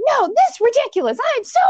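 high, loud, outraged; furious mom. Retold or imitated. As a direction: snap back at the husband in fury. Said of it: "She's like".

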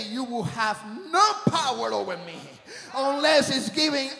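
loud, high shouted preaching; preaching at full force. Real, not acted. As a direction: drive it home like a revival preacher.

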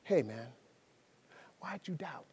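Jesus kindly asking Peter why he doubted; gentle question. Retold or imitated. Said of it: "Jesus began to have a conversation".

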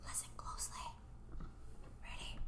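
hushed, secretive whisper. Acted. Sharing a secret story.

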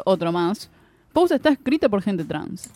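normal talking voice

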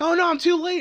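very high cartoon-character cry; hammed-up high dismay; imitated dismay. Retold character arriving too late.

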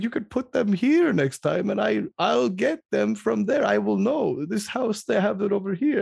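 put-on high, slowed Eastern European accent. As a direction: mimic an Eastern European man explaining carefully. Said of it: "I'm gonna do the accent".

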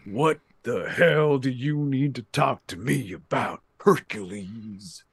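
slow gruff wrestling boss; Vince McMahon voice. Retold or imitated. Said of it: "He's like".

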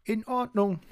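very high, carefree little voice; calling out. A narrator's reading. Schnuddel answers the raging dog, calling very high. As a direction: sing out agreement high and unbothered. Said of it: "rief Schnuddel".

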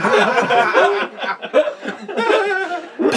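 high, helpless laugh; burst of laughter. Real, not acted. Reader laughing at the sexy name Portok, a burst of laughter. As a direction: lose it laughing. Reader cracks up at own story.

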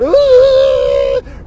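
long high-pitched loud whoop; Waterboy whoop. Retold or imitated. Likening himself to Waterboy running around the field, whooping. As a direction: let out a wild Waterboy holler. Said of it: "it's like fucking Waterboy".

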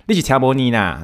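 Tainan tail 你啦 sung out at the end; Tainan accent. Shown as a demonstration. Teasing Tainan friends, with a Tainan ending. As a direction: let the Tainan ending 你啦 ring out. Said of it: "就台南的一个尾音".

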